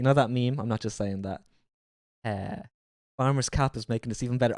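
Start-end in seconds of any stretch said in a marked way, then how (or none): none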